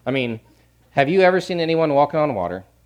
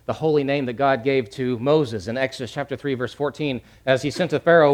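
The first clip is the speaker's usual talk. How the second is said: ordinary talk, nothing marked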